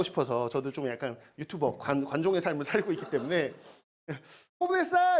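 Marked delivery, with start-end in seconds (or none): 4.6-5.2 high, loud call to a dog. Retold or imitated. Teacher calling her dog to come for a treat. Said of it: "이러면 오잖아".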